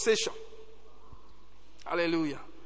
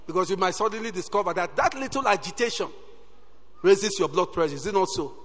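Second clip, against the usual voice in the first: normal talking voice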